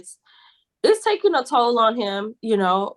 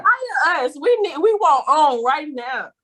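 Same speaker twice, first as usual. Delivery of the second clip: excited; 7 semitones higher than usual. Acted. Pitching to producers, hyped.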